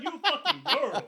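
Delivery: high, loud yell breaking into laughter; hammed-up sergeant's insult, laughing through it; mocking sergeant. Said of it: "He's like".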